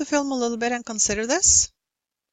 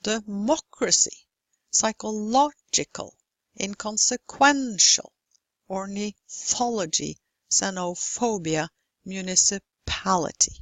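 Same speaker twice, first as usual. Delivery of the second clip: deMOcracy, psychoLOgical, inconseQUENtial, orniTHOlogy, xenoPHObia, municiPAlity; stressed syllable marked. Shown as a demonstration. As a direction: lean on MO, LO, QUEN, THO, PHO, PA. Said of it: "Which syllable receives stress in these?".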